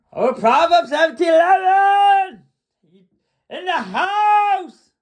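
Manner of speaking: loud hype-man shout, last word stretched; calling out. Real, not acted. Host greeting a commenter in the chat, calling out loudly. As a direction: announce them with a big, drawn-out holler.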